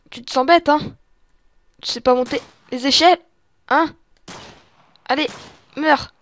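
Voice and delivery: loud taunting. Real, not acted. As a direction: jeer loudly at the stuck enemy. Taunting a zombie below him.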